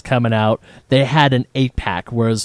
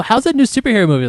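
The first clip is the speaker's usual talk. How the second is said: coworker voice; 9 semitones higher, faster than usual. Retold or imitated. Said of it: "he's like".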